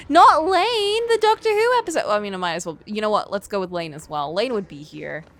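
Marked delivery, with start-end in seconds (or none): 0.1-2.0 high, loud laughing correction; loud exclamation. Real, not acted. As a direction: shout the correction. Correcting herself loudly.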